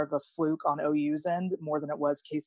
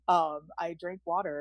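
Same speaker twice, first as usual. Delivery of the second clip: ordinary talk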